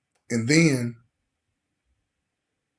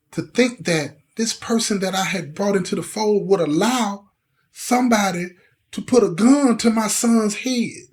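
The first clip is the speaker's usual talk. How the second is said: high, impassioned, building what-ifs; real anguish; 6 semitones higher than usual. Real, not acted. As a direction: plead out the worst case, rising to a peak. Anguished what-ifs about his son.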